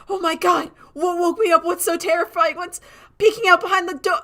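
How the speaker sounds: high, loud, wailing mock terror; laugh-cry it like a spooked kid; mock terrified. Streamer voicing her scared character, mock terrified wail. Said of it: "He's like".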